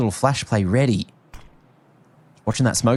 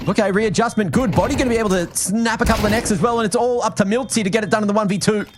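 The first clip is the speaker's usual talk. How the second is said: rising, much higher hype call; excited commentary; 9 semitones higher than usual. Real, not acted. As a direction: shout the defuse as it happens. Caster hyped at round end.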